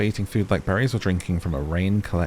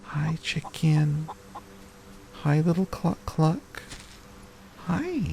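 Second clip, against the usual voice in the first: cutesy pet voice; 10 semitones higher, slower than usual. Real, not acted. Player cooing to a chicken in the game, high baby talk. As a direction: coo at the little chicken.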